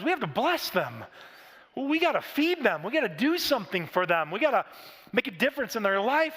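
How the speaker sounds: rally the disciples with rising urgency; urgent rallying cry. Retold or imitated. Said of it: "he tells the disciples".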